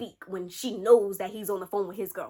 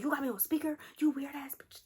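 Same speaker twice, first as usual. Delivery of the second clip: higher, whispery retold complaint; mock annoyed hiss; 6 semitones higher, 5 dB quieter than usual. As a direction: imitate him griping, half under the breath. Acting man annoyed at speakerphone.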